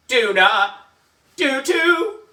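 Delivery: loud, rising back-and-forth squabble; kids bickering. Acted. AJ and Andrea squabbling, loud childish bickering.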